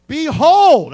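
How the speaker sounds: Behold belted out high and loud; loud proclamation. Real, not acted. Preacher calls out to his congregation, loud. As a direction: proclaim it to the whole room.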